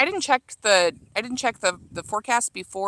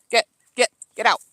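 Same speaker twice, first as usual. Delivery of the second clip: louder, higher shoo; 3 semitones higher, 7 dB louder, faster than usual. Real, not acted. Gardener shooing her dog out of the garden, loud shoo. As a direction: chase the dog off loudly.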